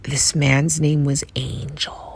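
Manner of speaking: slow hushed whisper. Real, not acted. Telling viewers the stranger's name, in an awed whisper. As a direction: breathe his name like a secret.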